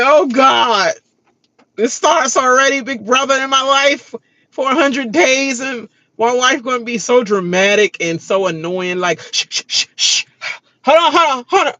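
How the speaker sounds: lightly voiced annoyed husband; imitating husband. Imitating her husband complaining about her, mildly annoyed. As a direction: grumble it like a weary spouse. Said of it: "it's like".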